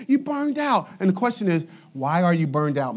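usual speaking voice

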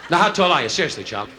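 ordinary talk, nothing marked

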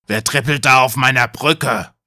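lightly growled troll challenge; a mild troll roar, timbre changed; troll demanding who's there. A narrator's reading. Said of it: "Da brüllte der Troll".

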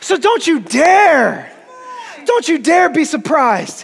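"don't you dare" stretched out slowly and pressed; slow emphatic warning. Real, not acted. Preacher's slow closing warning.

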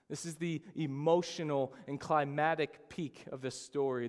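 usual speaking voice

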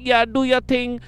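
egging him on in a put-on Jamaican accent; mock Jamaican. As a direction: imitate a hype man gone Jamaican. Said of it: "When this guy get Jamaican".

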